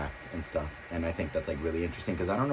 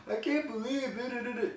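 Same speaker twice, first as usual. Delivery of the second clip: very high-pitched mock outburst; high, strained mock disbelief trailing into nonsense syllables; emotional outburst. Shown as a demonstration. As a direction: squeal it out as a hammed-up emotional preview. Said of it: "And one's gonna be".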